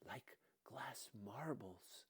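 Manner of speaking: slow, hushed whisper. Acted. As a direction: breathe it softly, like a secret. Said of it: "he whispered".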